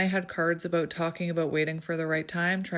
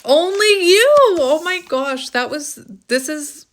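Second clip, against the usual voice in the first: loud, high, astonished cry; excited surprise; 9 semitones higher, 11 dB louder than usual. Real, not acted. Card reader seeing the card she drew, excited surprise. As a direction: burst out in delighted amazement, much louder and higher.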